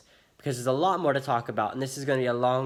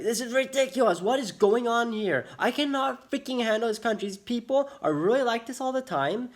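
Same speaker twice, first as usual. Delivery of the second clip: raised, high-pitched exasperated rant; retold angry yelling; 9 semitones higher than usual. Customer fed up with how the shop treats them, retold angry yelling. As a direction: re-enact losing your temper, voice pitched way up. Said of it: "I started yelling, like, raising my voice".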